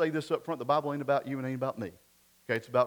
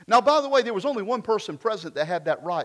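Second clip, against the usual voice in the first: ordinary delivery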